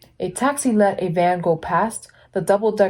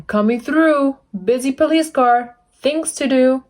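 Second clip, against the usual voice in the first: busy police car; 6 semitones higher than usual. Acted. Police car clearing traffic, brisk and raised. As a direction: hurry them aside like a busy cop.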